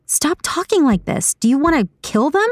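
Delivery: high-pitched, disturbed pleading; alarmed character voice without shouting; alarmed protest. A narrator's reading. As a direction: urge them to stop, voice high. Saga alarmed at talk of killing.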